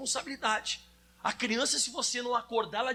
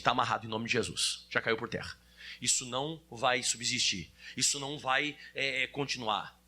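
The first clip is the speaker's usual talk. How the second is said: much lower, steady rebuking voice; firm rebuke; 11 semitones lower than usual. Retold or imitated. Preacher voicing a mature believer rebuking trouble, much lower and firm. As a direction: bind it with calm authority. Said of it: "E o adulto não".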